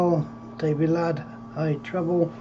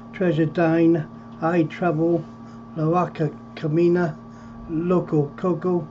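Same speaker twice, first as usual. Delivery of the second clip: usual speaking voice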